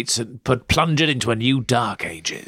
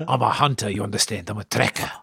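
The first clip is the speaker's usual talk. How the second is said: mock Keith accent; 3 semitones lower than usual.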